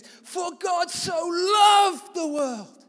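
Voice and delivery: slow, high, loud proclaiming of the verse; shouting scripture. Retold or imitated. Preacher recreating his shout at the crowd, slow and loud. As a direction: bellow it out slowly like calling a crowd. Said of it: "I shouted like this".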